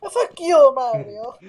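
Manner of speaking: high-pitched mock Italian voice; mock Italian accent. Luigi swearing at Mario, in a mock Italian accent. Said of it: "Talk in the Italian accent".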